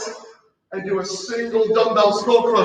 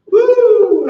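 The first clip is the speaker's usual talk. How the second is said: high loud whoop breaking into a laugh; 13 semitones higher, 6 dB louder than usual. Real, not acted. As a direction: shout for joy at the finish. Cheer after final plank.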